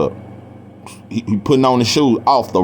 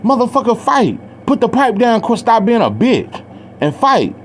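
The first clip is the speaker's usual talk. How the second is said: pitched-up challenge, a mock yell; angry challenge; 10 semitones higher than usual. The teen dares the brother to drop the pipe, mild mock shout. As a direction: dare him to drop the pipe. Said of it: "I'm like".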